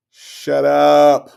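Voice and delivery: loud, drawn-out mock "shut up"; imagined fed-up outburst. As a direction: blurt out what she has been thinking all along. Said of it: "in the back of her head said".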